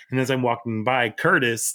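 normal talking voice

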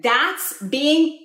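ordinary delivery

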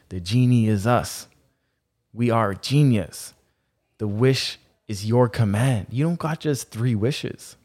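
usual speaking voice

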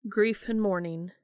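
usual speaking voice

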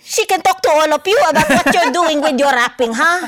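high, loud mock mom with a laugh in it; a teasing mother offering to guest on the podcast. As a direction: put on your mom's voice, hammed-up. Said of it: "when you did your mom's voice".

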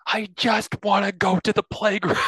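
put-on high, whiny little boy; whiny kid. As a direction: whine like a bored kid. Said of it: "the boy goes".

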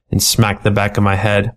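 ordinary delivery

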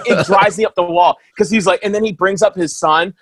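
usual speaking voice

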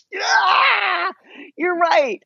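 high, pained, half-crying yeah, you're right; annoyed concession. Retold or imitated. To her husband after his jab, a high pained concession. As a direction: grudgingly admit he's right through gritted frustration. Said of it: "I was, like, so pissed".

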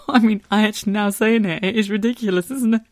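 high, helpless laughing speech. Real, not acted. Wife hearing how ridiculous she sounds, laughing. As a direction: talk through a rising fit of laughter. Breaks up laughing at her own request.

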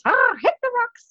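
very high squawky parrot echo; shrill-pitched bird voice repeating the words; squawking parrot. Acted. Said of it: "And the parrot goes".

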